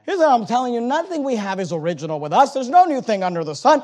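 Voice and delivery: climbing sermon voice; rising preaching. Acted. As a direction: drive the point home to the church. Preaching at full force.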